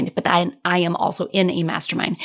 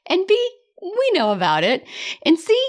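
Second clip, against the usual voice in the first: playful emphasis; 10 semitones higher than usual. Real, not acted. Playful list of points.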